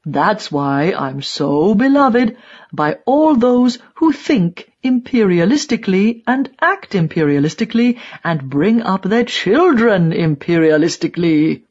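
put-on hammering old die-hard, sarcastic and emphatic; sarcastic tirade in character voice; vehement old ideologue. A narrator's reading. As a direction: pound each 'imperialistically' like a ranting old propagandist.

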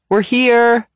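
high, loud call-out; yelled announcement. A narrator's reading. Zoe announcing their arrival from the foyer, yelling. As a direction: call through the house. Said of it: "she yells".

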